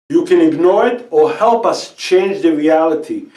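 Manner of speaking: usual speaking voice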